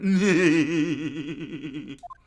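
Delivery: loud, long rolling cackle; mocking laugh. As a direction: prove it with a drawn-out cackle. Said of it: "But I am".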